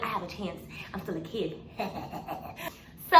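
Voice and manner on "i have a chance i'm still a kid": softer, hushed to herself; quietly hope, almost to yourself; hushed hopeful aside. Retold or imitated. Teen girl hushing hopes to herself before audition, softly. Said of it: "so I was like".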